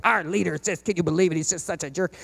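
hushed griping about the boss; griping in the break room. Retold or imitated. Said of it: "you go into the break room and you're like".

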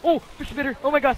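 high, rising alarm; alarmed cry. Real, not acted. Player spotting the spitter, alarmed cry. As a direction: cry out at a sudden game threat. Spots a monster in game.

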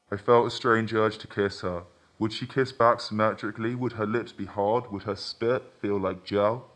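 ordinary delivery

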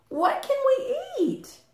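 very high tiny chick voice; little chick. Acted. First little chick asking Mother Hen for food, tiny high voice. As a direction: squeak it like a baby chick. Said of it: "Said the third little chick".

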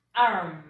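arm said in clear English, low. Shown as a demonstration. Teacher modelling the English word arm. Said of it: "Y se pronuncia".

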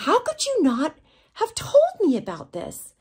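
high, loud indignant question; exasperated reproach. Retold or imitated. Agent upset the model never told her, exasperated reproach. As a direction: raise pitch and volume in disbelief.

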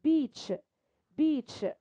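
بيتش ending in a clear ch sound; model pronunciation. Shown as a demonstration. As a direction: finish بيتش with a crisp tsh. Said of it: "بتجيب تشا". (نطق بيتش بصوت تش)

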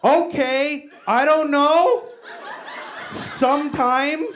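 high, slow, puzzled mom; mock a baffled mother in halting English; imitated mom. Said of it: "And she goes".

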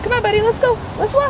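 high sing-song call to the dog; high pet-talk voice. Real, not acted. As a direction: coax the dog along brightly. Calling the dog on a walk.

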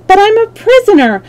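high, loud captive's plea; distressed girl begging to be freed; pleading. A narrator's reading. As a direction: plead for help, voice pitched well up. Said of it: "she pleaded".

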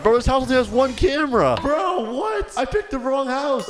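very high-pitched disbelief; real squeal of dismay; shocked dismay. Real, not acted. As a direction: voice shoots up in incredulous protest. Discovers only one camera.

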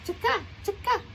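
high, chirped chicka; lizard call. Acted. Lizard peeping from the ceiling, high chirping.

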